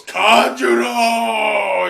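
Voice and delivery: a long, drawn-out furious scream of the name; hateful shout. Retold or imitated. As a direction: roar the name with hatred, slowly. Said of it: "seething with hatred as he goes".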